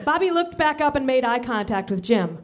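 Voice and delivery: ordinary talk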